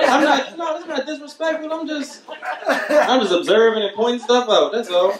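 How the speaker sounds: high laughing protest after teasing the hosts; high mock protest. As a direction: squeak out your innocence with a laugh. Comic protests innocence.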